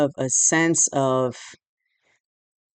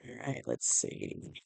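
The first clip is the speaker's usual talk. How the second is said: breathy whisper to herself, much softer; whispered aside; 17 dB quieter than usual. Real, not acted. Reader whispers to herself while turning to the cards, hushed. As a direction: murmur it privately under her breath.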